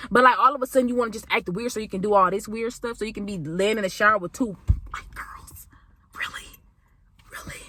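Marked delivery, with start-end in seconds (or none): 3.0-7.6 whispered gossip aside; scandalized whisper. Real, not acted. Telling viewers the scandal, whispered.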